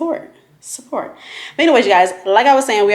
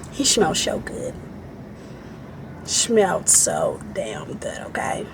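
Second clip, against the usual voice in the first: swooning hush; 6 semitones lower, 10 dB quieter than usual. Real, not acted. Gushing to viewers about a man's scent, soft and low. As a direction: breathe it out dreamily.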